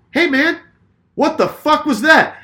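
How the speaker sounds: high, loud, slowed-down confrontation; retold fuming. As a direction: call out another driver in re-enacted fury. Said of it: "fucking fuming".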